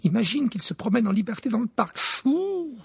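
put-on high, fast frightened girl; scared. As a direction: gasp at the thought. Hermione scared imagining.

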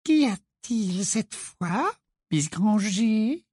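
high, slow, falsely sweet teacher; a sickly-sweet strict witch; Umbridge voice. A narrator's reading. Ombrage voiced by narrator. (voix haute et mielleuse)

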